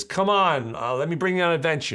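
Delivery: high, loud, rushed urging; excited mother. Acted. Mother inviting her son on an adventure, hammed-up excitement. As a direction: play a mother eagerly pulling someone along.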